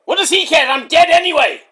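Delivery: high, loud shouted retort; indignant wrestler impression. Eddie retorting to the host, high indignant shout. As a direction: cry out high in a put-on voice.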